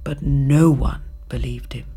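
plain reading voice

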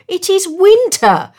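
high, loud storybook bird; emphatic high-pitched objection; protesting swallow. A narrator's reading. Swallow urgently protests to the prince.

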